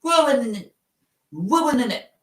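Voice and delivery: high, far-off mock British "Ruining it" with the t dropped; "Ruining it" sung up high like a Brit; mock British accent. Shown as a demonstration. Streamer mocking British slang, high mock British accent. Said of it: "British slang and all that".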